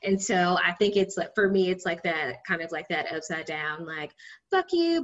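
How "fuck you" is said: sweet fuck you. Shown as a demonstration. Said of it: "but smiling".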